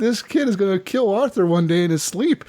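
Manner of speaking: high-pitched mock alarm between laughs; retold amused shock. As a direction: exclaim it high, half laughing. Recalls own amused shock, amid laughs.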